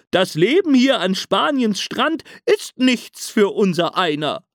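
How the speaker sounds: hohe, bellende Seehundstimme; bellender Seehund. A narrator's reading. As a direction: ruf ihn kumpelhaft zurück ins Meer. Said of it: "Da bellt aus einem Wellental ein Seehund". (hohes, bellendes Rufen)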